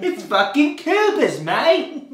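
high, loud, drawn-out mock Australian; Australian accent. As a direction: ham up the Australian accent loudly. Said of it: "as [name]'s Australian accent".

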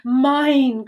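brief, insistent cry; possessive cry. A narrator's reading. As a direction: cry it out to claim the prize. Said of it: "cried Doris".

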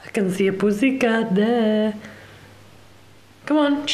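high singsong coaxing; pet talk. Real, not acted. Owner coaxes the cat toward the kitchen, in pet talk. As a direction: tease the cat along in a high voice.

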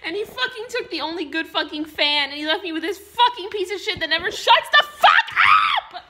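put-on yelling and swearing in a drunken rage; furious shout. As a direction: scream it out, furious and hurt. Drunk furious rant at Robin.

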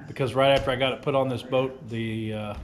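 ordinary talk

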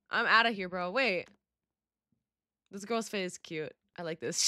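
usual speaking voice

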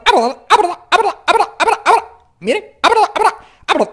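high, rapid-fire "ah, verdad" squawks; repeated cracked scratch syllables; scratch drill. Shown as a demonstration. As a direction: chop "verdad" short and strangled. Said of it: "van a hacer aquí".

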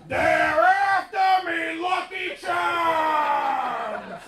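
high, loud, drawn-out mock leprechaun wail; hammed-up Irish shouting. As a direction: bellow it slowly like a ham actor playing Irish. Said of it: "all playing Irish guys".

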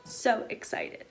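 slow excited whisper. Real, not acted. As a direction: whisper it with glee. Whispered repeat.

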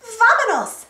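high, eager rallying cry; Dora shouting. A narrator's reading. Explorer rallies her friend to set off, shouting. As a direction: call out brightly to set off. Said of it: "Dora shouted".

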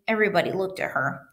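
usual speaking voice

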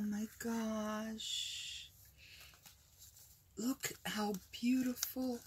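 soft, slow, awestruck; hushed and amazed; hushed awe. Real, not acted. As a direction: breathe it out in wonder, fading to a whisper. Awestruck at the gift.

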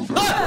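sudden startled scream; scream into giggles. Real, not acted. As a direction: yelp in real fright. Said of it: "You scared the fucking crap out of me".

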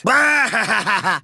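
long, gloating cackle; villainous laugh. Acted. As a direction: laugh triumphantly over the wreckage. Gloating after wrecking something.

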